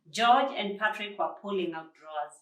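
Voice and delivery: ordinary delivery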